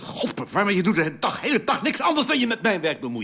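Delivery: high-pitched, heated protest; exasperated outburst. Acted. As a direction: explode in exasperation, pitch soaring. Acted quarrel, exasperated outburst.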